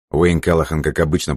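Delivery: ordinary delivery, nothing marked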